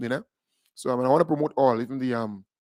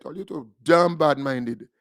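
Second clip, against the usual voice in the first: ordinary talk, nothing marked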